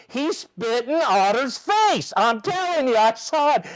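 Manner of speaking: indignant tattling. Retold or imitated. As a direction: imitate a dugout calling out an accusation. Acting out the dugout yelling complaint.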